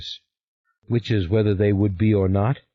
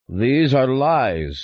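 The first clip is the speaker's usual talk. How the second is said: slow, raised denial; stern father; 6 semitones higher, 4 dB louder, much slower than usual. A narrator's reading. Father refuting the sister, slow and emphatic. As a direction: drag out the denial loud and high. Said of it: "said my father".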